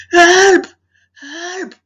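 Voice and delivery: high, loud shouted call for help; lion cries for help. Acted. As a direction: yell it like a trapped lion. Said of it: "He started to cry for help".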